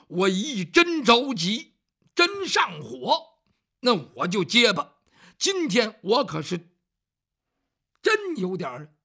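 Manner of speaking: flustered servant, loud and slow; agitated. Acted. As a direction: press every word out, worked up.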